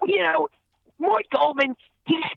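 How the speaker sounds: high-pitched cartoon character voice; Mort Goldman. Voice actor performing on request, Mort Goldman voice. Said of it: "what would Mort Goldman say?".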